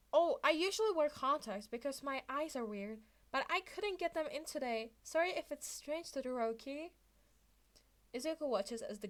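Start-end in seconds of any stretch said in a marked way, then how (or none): 0.1-6.9 feigned innocence. Acted.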